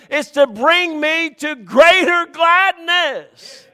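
high, loud preaching climax slowing into the end; preaching at full force. Acted. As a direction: build the sermon up to its peak.

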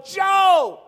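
loud high holler of the name; yell. Retold or imitated. Hunter dangling from a limb to his buddy, alarmed yell. Yelling at friend clinging to him.

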